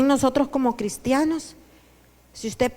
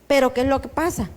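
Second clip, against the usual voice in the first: ordinary delivery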